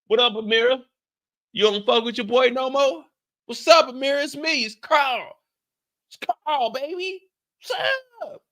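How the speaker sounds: lightly voiced, high come-on to Amira; flirty Carlton. Carlton trying to win back Amira, high flirty come-on. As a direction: play Carlton sliding up on her.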